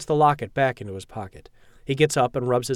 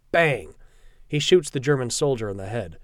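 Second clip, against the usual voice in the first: ordinary delivery, nothing marked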